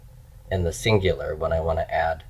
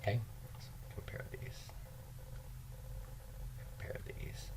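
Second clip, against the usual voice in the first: whisper; 3 semitones higher, 26 dB quieter than usual. Real, not acted. Muttering to self while writing.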